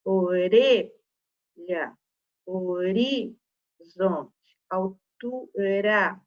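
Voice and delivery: "Orelha, horizonte, altura" devagar, R de um toque; R fraquinho. Shown as a demonstration. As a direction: alongue as sílabas de "Orelha, horizonte, altura" destacando o R fraco. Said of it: "Olha o som do R". ("Orelha, horizonte, altura" silabado, com R leve)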